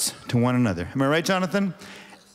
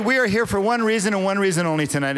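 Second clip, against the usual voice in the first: higher, louder emcee greeting; showman welcome; 7 semitones higher, 3 dB louder than usual. Acted. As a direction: open the show with a big hello. Emcee opens the ceremony.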